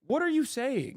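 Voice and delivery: high, disbelieving what are you saying; high incredulous question. Real, not acted. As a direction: pitch it up in disbelief. Exasperated rant at chat.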